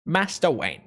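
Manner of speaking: lightly voiced British butler Alfred; a hint of a British butler impression; Michael Caine. Streamer mimicking Alfred from The Dark Knight, light British impression. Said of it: "sounded like Michael Caine".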